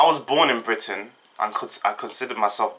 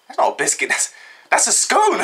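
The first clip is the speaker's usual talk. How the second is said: mock disbelief; 13 semitones higher, 3 dB louder than usual. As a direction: voice shooting up into a high squeal. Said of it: "I was like".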